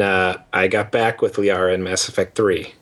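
usual speaking voice

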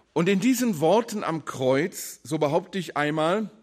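ordinary talk

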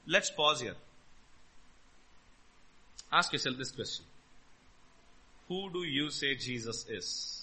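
low, soft, with long pauses; hushed, weighty preacher inviting reflection; solemn questioning. Real, not acted. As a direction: let the question sink in quietly. Solemn pause for personal self-examination.

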